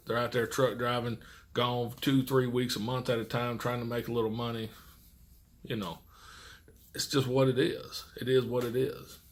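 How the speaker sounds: ordinary talk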